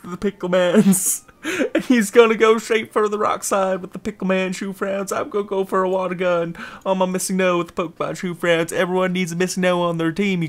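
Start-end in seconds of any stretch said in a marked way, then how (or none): none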